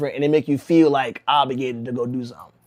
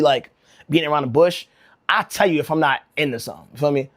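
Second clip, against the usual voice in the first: ordinary talk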